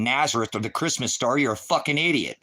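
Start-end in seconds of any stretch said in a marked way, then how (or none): none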